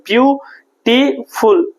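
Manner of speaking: ब्यूटीफुल split as ब्यू, टी, फुल; three separate units. Shown as a demonstration. Teacher demonstrating units of speech, ब्यूटीफुल said in three parts. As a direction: give ब्यूटीफुल in three distinct beats. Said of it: "तीन unit में बोल रहे हैं".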